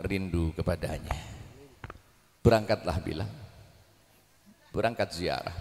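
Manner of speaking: gentle urging. Acted. Relative gently urges Bilal.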